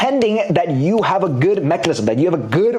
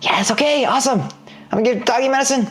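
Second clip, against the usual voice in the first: put-on higher eager kid voice; a boy thrilled to help his dog; excited little Jimmy; 8 semitones higher, faster than usual. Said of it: "So Jimmy goes".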